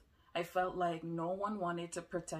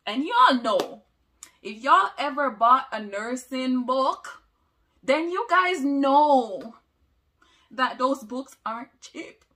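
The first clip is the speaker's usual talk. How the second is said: much louder and higher, talking straight to viewers; loud emphatic appeal; 6 semitones higher, 15 dB louder than usual. Real, not acted. Telling viewers that nursing books are expensive, in a loud, emphatic voice. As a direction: raise your voice to make the point land hard.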